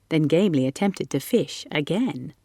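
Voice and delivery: ordinary delivery, nothing marked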